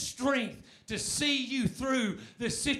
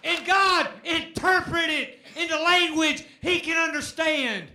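preaching at full force; 6 semitones higher, 8 dB louder than usual. Real, not acted. Preacher urging the congregation to shout hallelujah. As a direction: whoop it up for the church.

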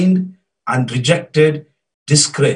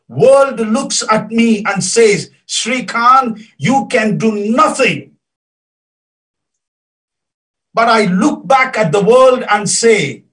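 high, loud, impassioned sermon delivery; soaring pulpit voice, pushed hard; fervent preaching; 8 semitones higher, 5 dB louder than usual. Acted. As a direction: preach it out at full force to the congregation. Preaching at full force.

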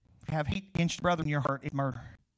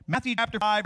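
ordinary talk

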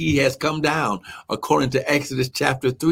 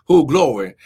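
usual speaking voice

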